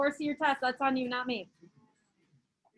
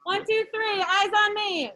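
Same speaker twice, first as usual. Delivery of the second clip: put-on high sing-song call to little kids; kindergarten teacher voice; 6 semitones higher, slower than usual. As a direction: chant it like for five-year-olds. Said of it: "we can do kindergarten".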